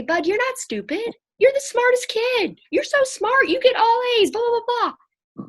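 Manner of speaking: high, loud, gushing praise piled on fast; over-the-top cheerleading mom voice; overeager reassuring mom. Retold or imitated. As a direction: pour on the compliments like a frantic mama. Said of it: "is I wanna say".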